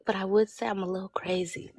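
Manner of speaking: quick secretive whisper. Real, not acted. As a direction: whisper it like a confession. Confessing aside.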